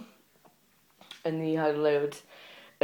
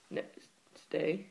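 normal talking voice

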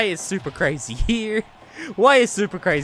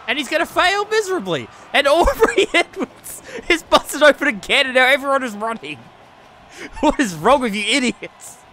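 usual speaking voice

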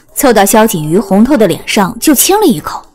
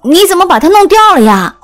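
higher-pitched, fretful exclamation; anxious, urgent; 7 semitones higher than usual. A narrator's reading. Girl seeing his bandage has come off, urgent. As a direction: blurt it out in worried reproach. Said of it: "急道".

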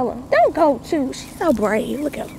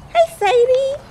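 high greeting; 14 semitones higher, slower than usual. Real, not acted. Greeting daughter.

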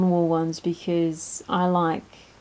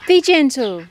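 scolding; 4 semitones higher, 7 dB louder than usual. Real, not acted. Scolding the ducks.

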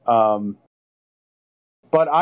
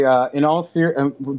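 ordinary talk, nothing marked

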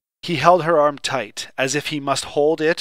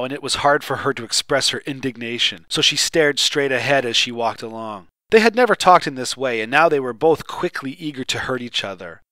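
plain reading voice

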